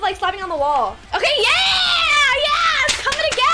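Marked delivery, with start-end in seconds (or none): 1.1-3.5 excited. Real, not acted. Excited as the work comes together.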